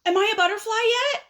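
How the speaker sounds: high, quick pestering question; impatient caterpillar. Acted. Caterpillar pesters his friend about changing, impatient. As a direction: rattle it off high and eager. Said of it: "he says".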